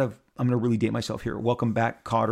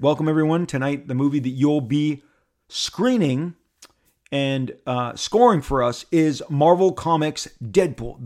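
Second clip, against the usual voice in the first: raised, showy announcement; announcer welcome; 3 semitones higher, 5 dB louder than usual. Retold or imitated. Host welcoming the screening audience, announcer style. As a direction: build it up like a host.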